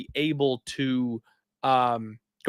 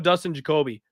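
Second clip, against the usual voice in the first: ordinary delivery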